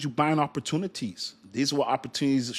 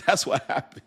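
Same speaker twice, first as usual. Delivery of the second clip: laughing; 5 semitones higher than usual. Real, not acted. Laughs after the joke.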